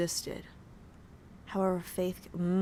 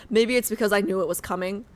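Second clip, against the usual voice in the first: ordinary talk, nothing marked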